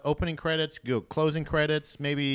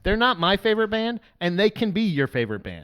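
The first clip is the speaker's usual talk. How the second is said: loud firm telling-off, pitched up; mock scolding command; 5 dB louder than usual. Scolding a fan who praises Trapped, loud mock command. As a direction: bark it at a dumb dog. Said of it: "giving a command to".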